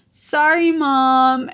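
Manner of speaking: high, loud tearful apology; mock-crying kid voice; imitating crying son. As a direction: apologize like a weepy child. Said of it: "crying. He's like".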